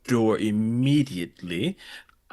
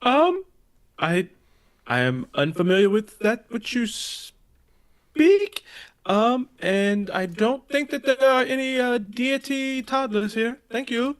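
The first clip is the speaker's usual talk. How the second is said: a hint of a feigned stiff voice, higher and slow; Fate's attempt at a deep voice; 14 semitones higher, 3 dB louder, slower than usual. Fate at the door to cultists, lightly voiced calm denial. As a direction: play it calm and non-assertive while denying everything. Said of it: "deepest voice fate can muster".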